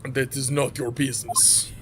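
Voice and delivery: curt low Russian dismissal; guard refusing a question, Russian accent. Acted. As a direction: shut them down flatly, Russian-accented. Sailor voice.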